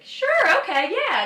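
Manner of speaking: very high, loud, overeager yes; overeager agreement. Retold or imitated. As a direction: pipe up loudly, far too keen. Playing along with a stranger's invitation.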